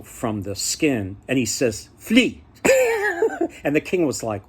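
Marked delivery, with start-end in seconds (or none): 2.0-2.4 higher and louder, one triumphant word; hammed-up ogre announcing his answer. Ogre names the flea skin. 2.6-3.6 high, loud gloating laugh; a hammed-up ogre's triumphant cackle. Ogre's triumphant laugh after answering.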